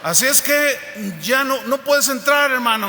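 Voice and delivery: portero negando la entrada. Retold or imitated. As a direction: play an insistent doorman, loud and high. (portero tajante, voz alta y aguda)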